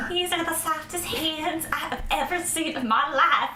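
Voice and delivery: high, put-on mocking gush; mock them with fake admiration. Player grabbing Gervaise's hand to make fun, high mocking voice. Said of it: "I mockingly go".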